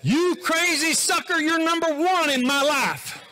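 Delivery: high, loud, slow sarcastic yell at a driver; mock road rage. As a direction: yell at another car, hammed up. Road rage while driving.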